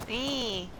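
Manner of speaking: ordinary delivery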